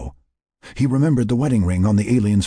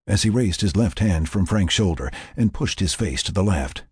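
plain reading voice

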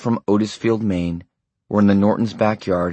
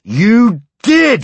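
bellowed, much higher and louder; bellowing; 9 dB louder than usual. A narrator's reading. Husband bellowing at his wife, cutting her off. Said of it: "he bellowed".

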